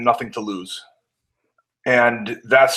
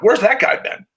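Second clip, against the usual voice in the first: much higher, impressed exclamation; surprised boss; 9 semitones higher, 3 dB louder than usual. Retold or imitated. Boss impressed after the promo, voice jumps up high. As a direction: pitch it up high like a stunned boss. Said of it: "he was like".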